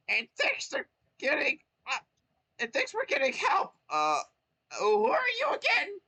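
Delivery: very high, loud squeaky thanks; grateful Toad. Acted.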